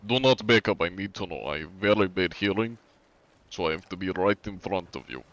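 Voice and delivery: hammed-up KGB interrogator with Russian accent. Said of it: "This sounds like big sweaty Russian man".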